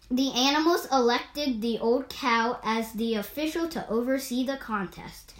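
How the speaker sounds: plain reading voice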